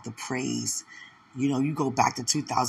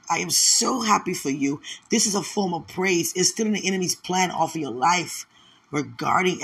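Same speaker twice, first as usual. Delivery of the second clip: raised pitch, ringing louder declaration; exultant praise; 5 semitones higher, 3 dB louder than usual. Real, not acted. Preacher declaring happiness over a listener, exultant praise. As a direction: proclaim it joyfully, high and strong.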